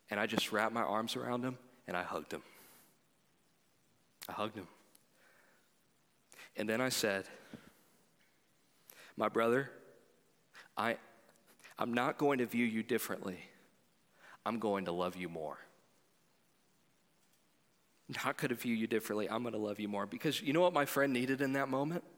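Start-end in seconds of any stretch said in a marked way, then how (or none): 1.9-4.7 tender hush. Real, not acted. Pastor recalling hugging his weeping friend, soft and low. Emotional hug retold quietly. 9.2-20.1 low, soft, tender promise; reassuring friend. Retold or imitated. Pastor comforting his weeping friend, soft and low.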